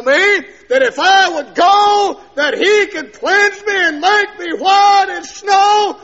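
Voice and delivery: slow, high, loud preaching holler; shouted disbelief. Retold or imitated. Sinner doubting he could be cleansed, shouting. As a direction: build to an incredulous drawn-out shout.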